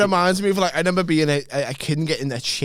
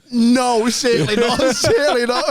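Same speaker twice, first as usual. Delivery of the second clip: higher, louder panicked denial, breaking into laughter; mock panic; 7 semitones higher, 3 dB louder than usual. Boy denying it to an angry dad, mock panicked. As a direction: act out a frantic denial, half laughing. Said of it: "the panic of, like".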